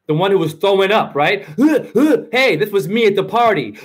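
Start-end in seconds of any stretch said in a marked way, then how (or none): 1.4-2.2 mock heaving gasps, higher; panting heaves. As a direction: act out straining breaths while holding a sick friend. Imitating himself heaving at party.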